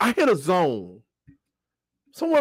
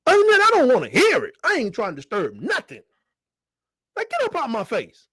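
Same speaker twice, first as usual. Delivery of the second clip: mom scolding; 5 semitones higher than usual. Retold or imitated. Mother voice continues scolding.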